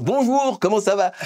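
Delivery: mock eructation, high and emphatic; demonstrated loud blurting. Shown as a demonstration. Pastor shows what éructer means, speaking very loudly. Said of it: "je vais parler très fort".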